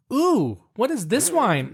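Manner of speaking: high mock-delighted "ooh"; imagined wine drinkers' reaction. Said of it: "people that would be like".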